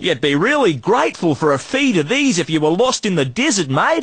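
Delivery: hammed-up Aussie salesman twang; broad Australian accent. Street trader hawking junk in a broad Australian accent. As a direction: lay on the outback Australian accent thick. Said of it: "we only talk like that".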